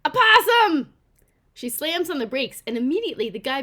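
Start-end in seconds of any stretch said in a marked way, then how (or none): 0.0-0.9 high shrieked alarm; scream. Acted. Neighbor spotting a possum on the road, shrieked alarm. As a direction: yell it out in sudden alarm. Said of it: "Gracie screams".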